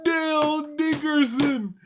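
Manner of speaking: slow, drawn-out and high name call; stretched hype call. Real, not acted. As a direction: drag the name out like a hype man. Streamer hyping a guest's entrance.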